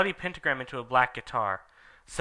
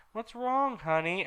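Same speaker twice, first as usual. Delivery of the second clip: put-on tender boyfriend; 9 semitones higher, 3 dB quieter than usual. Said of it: "asked Draco".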